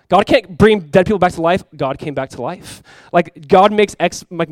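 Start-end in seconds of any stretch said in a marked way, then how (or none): 0.1-1.6 louder, emphatic mock denial; scoffing denial. Ex-mocker once denying God's power, loud scoffing. 1.7-2.8 softer, slow settled answer; quiet conviction. Retold or imitated. Ex-mocker now believing, quiet slow conviction.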